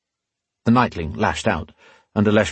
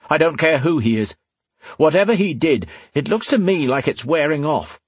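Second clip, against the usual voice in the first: higher-pitched, tense observation; 6 semitones higher than usual. A narrator's reading. Rion warning that the glow is wearing off, tense. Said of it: "Rian observed in a tense voice".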